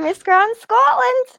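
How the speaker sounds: high, drawn-out pageant announcement; pageant intro. Retold or imitated. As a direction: announce the title slowly and high. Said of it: "your pageant intro".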